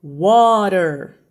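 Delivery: WATER loud with a long first vowel; loud, slow WATER, falling at the end; slow teaching demo. Shown as a demonstration. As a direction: stretch out WATER for a learner to copy. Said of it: "Eu vou falar devagar agora".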